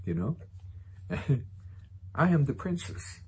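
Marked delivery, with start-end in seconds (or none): 2.2-3.2 mock princess voice. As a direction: play a ninety-year-old acting grand. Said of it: "Coming from the lips ... ninety-year-old woman".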